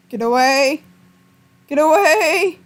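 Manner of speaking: loud shout, rising to a yell; real frightened yelling; panicked shout. Real, not acted. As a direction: yell it away from you. Shouting at game monster.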